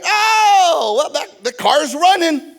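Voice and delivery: high, drawn-out mock hesitation; awkward hedging. Buyer answering the seller about the car, awkward high hedging. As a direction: stall loudly in a high voice, then hedge.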